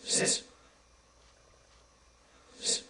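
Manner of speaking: very soft, brief hiss; silent cloud noise. Acted. The big cloud answering the bears, barely audible. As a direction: let out a tiny, faint puff of sound. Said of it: "went like this".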